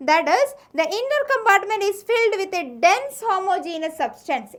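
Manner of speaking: slow, deliberate textbook dictation; teacher dictating notes slowly. Shown as a demonstration. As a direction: read it out word by word for notes.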